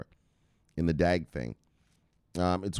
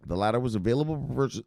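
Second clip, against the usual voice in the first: rushed read-aloud, slightly higher; talking fast; 4 semitones higher, faster than usual. Real, not acted. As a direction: rattle through the sentence quickly. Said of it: "I'm talking fast".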